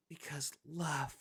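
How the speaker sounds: slow, hushed, drawn-out mock plea; mock love-struck heroine. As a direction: lightly imitate a dreamy film heroine. Mocking a film character's love speech.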